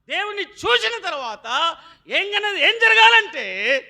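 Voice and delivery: slow, loud, drawn-out build; slow pulpit build-up; emphatic preaching. Acted. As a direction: stretch each word to the crowd.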